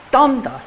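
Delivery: "dum" hit hard and high, "da" dropped; strong-then-weak: DUM da; reversed beat. Shown as a demonstration. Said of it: "I will turn it around and go".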